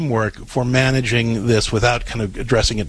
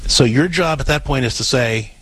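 usual speaking voice

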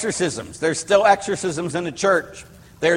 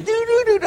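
high sung doorbell chime; a playful high doorbell imitation; imitated doorbell; faster than usual. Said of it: "the doorbell's ringing".

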